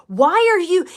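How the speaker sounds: loud, high, imitated indignant demand; almost mad. Storyteller imitating the startled tour lady, almost mad. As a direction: act out her cross challenge, pitch jumping up. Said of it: "she was, like, almost mad at me".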